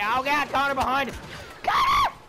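high loud shout for Connor; shouting a warning. Real, not acted. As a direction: yell at your teammate to turn around. Warning teammate of enemy.

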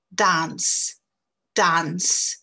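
"dance" with a long open A, no nasal; long English A. Shown as a demonstration. Teacher drilling French learners, long A. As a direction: stretch the A in "dance". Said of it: "La danse, danser".

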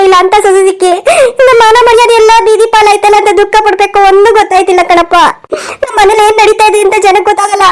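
mother's feigned tearful lament; put-on weeping. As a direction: wail reproachfully to win over the son. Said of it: "ಅಳ್ಬೇಡ ಅಮ್ಮ". (ಅಳುವ ನಾಟಕದ ಗೋಳಾಟ)

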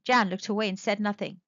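ordinary delivery

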